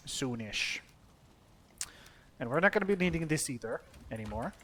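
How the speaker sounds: usual speaking voice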